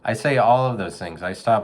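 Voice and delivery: ordinary talk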